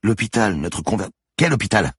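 high, halting, frantic question; agitated confusion. A narrator's reading. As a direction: stumble into an anxious question. Manon confused, growing frantic.

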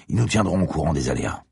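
ordinary delivery, nothing marked

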